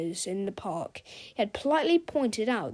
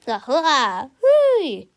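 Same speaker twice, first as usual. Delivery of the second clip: triumphant cheer; 4 semitones higher, 10 dB louder, much slower than usual. A narrator's reading. Hero's triumphant cry.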